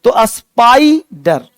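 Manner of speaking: स्पाइडर drawn out very slowly, syllable by syllable; slow pronunciation demo. Shown as a demonstration. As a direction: spell out स्पाइडर slowly for the class. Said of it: "एस पी के बाद आई vowel". (स्पाइडर को बहुत धीरे, एक एक हिस्सा बोलकर)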